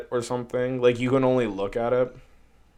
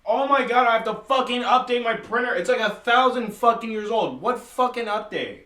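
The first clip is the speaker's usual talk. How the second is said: exasperated rant; 10 semitones higher than usual. Real, not acted. Furious at outdated printer.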